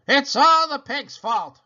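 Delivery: put-on slow, loud haughty old woman voice; stern sister. A narrator's reading. Eugenia blames pig.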